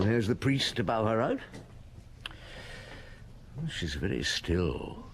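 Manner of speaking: low, hushed thinking-aloud voice; inner monologue. Acted. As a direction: think it to yourself in the pew. Inner thoughts during the Mass.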